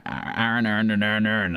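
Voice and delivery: "Aaron" run together in a mock Boston accent. Streamer joking that Boston merges everything, mock Boston accent. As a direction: blur "Aaron" over and over, Boston-style. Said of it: "you go to, is it Boston?".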